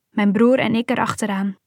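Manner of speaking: ordinary delivery, nothing marked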